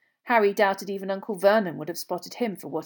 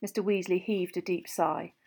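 plain reading voice

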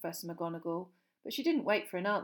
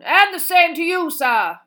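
high, loud roar of a blustering knight; 10 semitones higher, 19 dB louder, slower than usual. A narrator's reading. Sir Cadogan roars back at Ron's insult, loud. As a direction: bellow it back. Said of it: "roared Sir Cadogan".

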